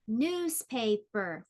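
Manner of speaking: "Newspaper" slowly in three beats; measured, drawn-out syllables in "Newspaper"; split into syllables. Shown as a demonstration. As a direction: say "Newspaper" as news, pa, per. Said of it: "divide words into syllables".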